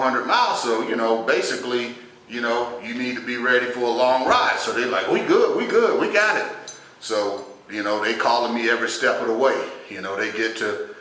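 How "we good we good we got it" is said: high, quick mock confidence; breezy assurance. Relatives dismissing warnings about the long drive, breezy assurance. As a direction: wave off the worry in a raised, cocky voice.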